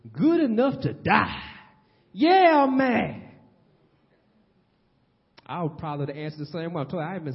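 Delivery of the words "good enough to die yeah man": loud, higher defiant Jonah; sulky prophet snapping back, louder; defiant retort. Acted. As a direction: throw it back at God, stubborn. Jonah's defiant answer to God.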